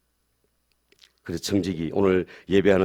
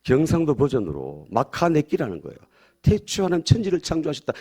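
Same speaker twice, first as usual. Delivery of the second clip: usual speaking voice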